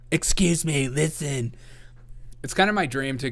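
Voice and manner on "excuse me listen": leftist character voice. Retold or imitated. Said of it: "a leftist character".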